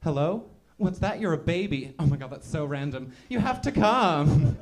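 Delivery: loud, high gushing on the phone; hammed-up party excitement. Acted excited phone call to baby.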